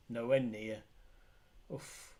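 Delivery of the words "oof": ordinary delivery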